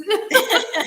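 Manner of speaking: a high, real burst of laughter; laughter at the barking dog. Real, not acted. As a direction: break into a laugh.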